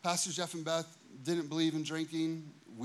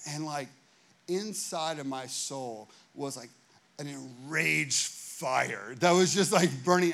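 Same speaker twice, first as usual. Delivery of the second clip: normal talking voice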